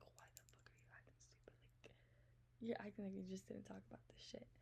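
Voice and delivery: soft, hissed whisper of disbelief; exasperated whisper. Retold or imitated. Asking a clueless friend why he acts stupid, exasperated whisper. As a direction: whisper it like you can't believe him.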